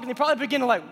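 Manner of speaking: usual speaking voice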